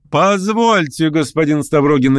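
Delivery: high-pitched, sharp interruption; sharp address. A narrator's reading. Hostess stops Stavrogin as he leaves, sharply. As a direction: stop him sharply. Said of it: "резко обратилась к нему хозяйка".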